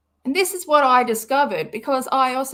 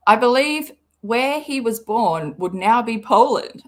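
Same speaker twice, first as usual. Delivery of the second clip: ordinary delivery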